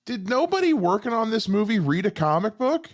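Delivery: high-pitched exasperated question; real indignant bafflement, voice pushed up; frustrated. Real, not acted. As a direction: complain in pleading disbelief. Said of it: "I'm frustrated here".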